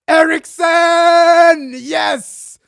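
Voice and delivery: huge, high, drawn-out yell of the scorer's name; goal celebration shout. Real, not acted. Fan celebrating a goal on the TV, roaring, high and drawn out. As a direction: scream it as the ball hits the net.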